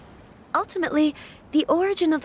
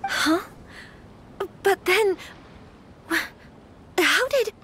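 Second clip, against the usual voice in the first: flustered surprise; 5 semitones higher, much slower than usual. Acted. Bride learns the letter wasn't from her, flustered and stammering. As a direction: voice jumps higher as she stammers, lost for words.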